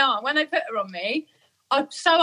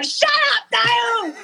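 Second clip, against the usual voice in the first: retold shout; 10 semitones higher, slower than usual. Mid-labour mum yelling at Dale Winton on TV, mock shout. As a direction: bark it at the telly host. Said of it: "I was shouting at him".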